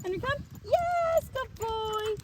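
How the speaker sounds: high and loud, over-the-top cheering; hammed-up party voice for a dog; silly excited praise. Shown as a demonstration. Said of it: "silly, obnoxious noises".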